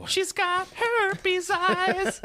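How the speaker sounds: high, slow squeaky mock voice. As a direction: stretch it out in a silly high squeak. Mocking the half mask figure.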